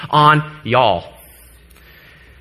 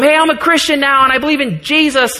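put-on higher, eager young-convert voice; eager announcement; 7 semitones higher than usual. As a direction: blurt it out like an excited teenager. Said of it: "told them".

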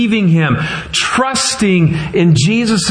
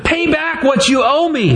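high-pitched, insistent demand; acted demand, not shouted; 9 semitones higher than usual. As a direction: demand the debt in a raised pitch. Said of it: "he demanded".

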